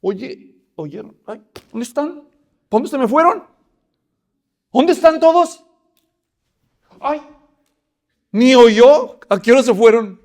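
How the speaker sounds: high, loud, rising calls for the missing men; David finds himself alone, confused calls; bewildered calling. Acted. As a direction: look around and call out in disbelief. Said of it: "yo creo que dijo".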